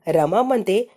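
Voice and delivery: plain reading voice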